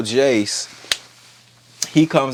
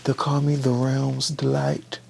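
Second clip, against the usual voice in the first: smug prince; 7 dB quieter than usual. Retold or imitated. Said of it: "it's like".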